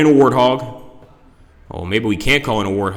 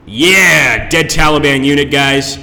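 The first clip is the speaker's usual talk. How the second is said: excited cheer; 3 semitones higher, 7 dB louder, slower than usual. Real, not acted. Cheering a kill in game.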